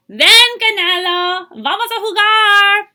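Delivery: high, loud call across the garden; calling the dog. A narrator's reading. Child in the garden calls Canelo the dog, loud call. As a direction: call the dog to come play.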